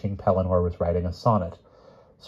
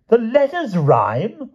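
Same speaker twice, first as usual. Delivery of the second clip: high, loud, indignant king; crying out; 5 dB louder, much slower than usual. A narrator's reading. King defends his rhymes to the knight, crying out high. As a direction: protest in a high, raised voice. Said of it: "cried the king".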